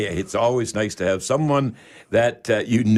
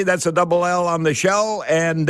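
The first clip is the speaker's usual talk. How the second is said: normal talking voice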